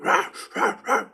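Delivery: high unvoiced mock barking; imitated dog bark. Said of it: "That motherfucker starts barking".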